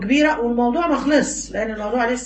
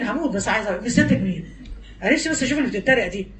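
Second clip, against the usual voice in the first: usual speaking voice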